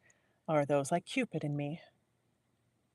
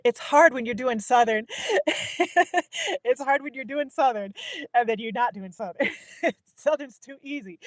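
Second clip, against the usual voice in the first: higher, louder laughing remark; 8 semitones higher, 6 dB louder than usual. Real, not acted. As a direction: laugh while explaining the slip. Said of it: "I'm getting a little Southern".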